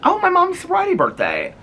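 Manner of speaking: high, sudden exclamation; surprised realization. Real, not acted. Notices a meaningful date.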